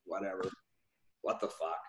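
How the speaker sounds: low, soft grumble to oneself; under the breath. Retold or imitated. Agent after hanging up on his client, retold under his breath. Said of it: "said a few things under my breath".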